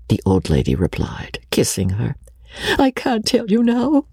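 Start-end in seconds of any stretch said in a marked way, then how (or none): 2.8-4.1 high, tearful refusal; sobbing. A narrator's reading. Rebecca refusing to tell her secret, sobbing. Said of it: "sobbed Rebecca".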